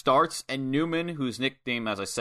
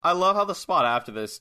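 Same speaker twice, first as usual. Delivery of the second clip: normal talking voice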